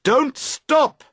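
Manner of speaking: high, loud call across the water; shouting. A narrator's reading. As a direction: rower shouting to the towing girls. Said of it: "You shout to them".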